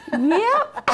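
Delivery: high, loud yelp into laughter; laughing admission. Real, not acted. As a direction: squeal it, then crack up. Laughs at graduation year comparison.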